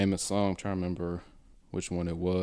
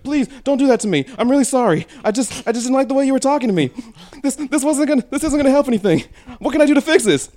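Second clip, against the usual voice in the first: put-on girl voice; 11 dB louder, faster than usual. Girl voiced pleading in story.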